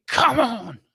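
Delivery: high, loud rallying cry; rallying fans cheer; excited shout. Real, not acted.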